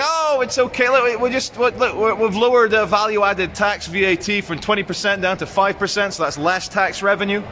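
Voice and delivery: high, mocking politician voice; Rishi Sunak. Vlogger mocking the finance minister's tax excuse, high mock voice. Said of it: "he's saying".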